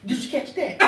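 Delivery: ordinary talk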